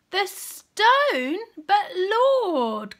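high, shocked protest; cried out. Acted. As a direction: cry out in disbelief. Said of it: "cried Martha".

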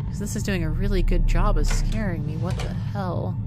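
usual speaking voice

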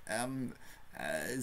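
ordinary delivery

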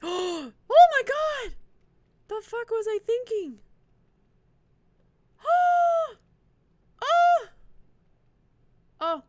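high gasping shrieks of shock; real startled cries, high and loud. Real, not acted. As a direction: yelp out in sudden fright. Gasping in alarm during game.

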